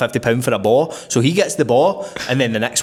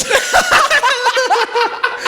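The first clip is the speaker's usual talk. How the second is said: high burst of laughter; big laugh; 3 dB louder than usual. Real, not acted. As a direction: crack up mid-story. Laughing at own story.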